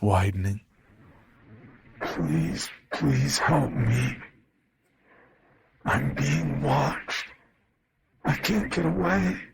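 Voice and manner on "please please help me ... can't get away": slow, higher, strained plea from a monster's mouth; strained pleading voice. Acted. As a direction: beg slowly in a strained, eerie voice. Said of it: "My father's strained voice".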